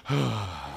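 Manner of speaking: a long soft sigh of relief; relieved group sigh. Retold or imitated. Whole class sighing with relief as the kid gets it right. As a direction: let out a quiet relieved sigh.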